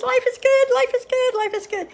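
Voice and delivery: high, excited chant as a wagging tail; bouncy high-pitched tail-wag voice; happy dog's tail. Acted. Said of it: "and their tail goes".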